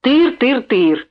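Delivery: mock her babbling; hammed-up teasing parody; funny mocking imitation. A narrator's reading. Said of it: "смешно передразнил он её".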